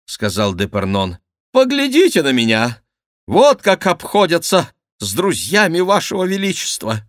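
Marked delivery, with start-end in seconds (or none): 1.5-7.0 high, loud, aggrieved complaint; indignant courtier. Acted. Battered favourite shows himself to his king, indignant and high. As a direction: complain to the king in a raised, agitated voice.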